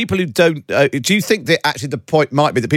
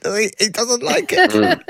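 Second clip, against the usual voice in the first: mock whiny; 12 semitones higher, slower than usual. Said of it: "Oh, he doesn't like it".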